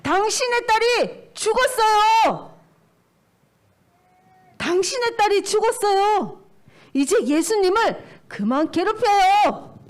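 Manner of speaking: acted messenger's high-pitched cry. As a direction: call the bad news out loudly, stretching the endings. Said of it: "이렇게 외쳤어요".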